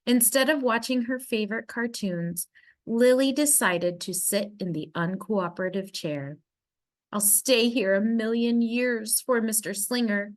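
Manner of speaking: usual speaking voice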